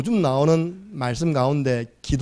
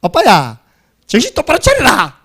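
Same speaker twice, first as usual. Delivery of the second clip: loud, high-pitched Gyeongsang scolding; bossy sat-nav in broad Gyeongsang dialect; 6 semitones higher, 7 dB louder than usual. Retold or imitated. Preacher mimics car navigation warning the driver, loud Gyeongsang dialect. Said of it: "경상도 버전".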